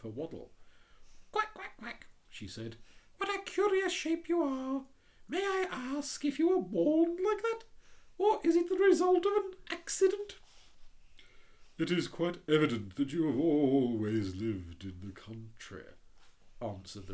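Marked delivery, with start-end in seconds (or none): 1.3-2.1 quacks way up high; squeaky little duck calling; cartoon duck quacking high. 3.2-10.4 much higher, curious and chatty; a fussy duck asking, voice pitched way up; prim little duck voice, high. Acted. The Duck speaking. 11.8-15.8 a touch louder, proud and slow; hint of a haughty rocket; lightly voiced pompous Rocket. The Rocket answering.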